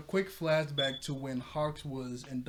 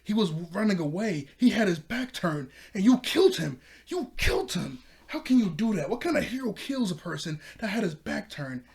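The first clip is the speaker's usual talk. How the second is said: higher, louder angry outburst, retold; really mad; 6 semitones higher, 7 dB louder than usual. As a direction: act out an outraged accusation, building in force. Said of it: "he is really mad. He goes".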